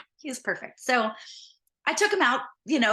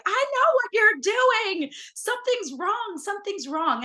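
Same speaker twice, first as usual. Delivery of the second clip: high, strained plea through tears; tearful plea; 12 semitones higher than usual. Retold or imitated. Crying to the fish in the parking lot, tearful. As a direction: beg the fish to say what's wrong.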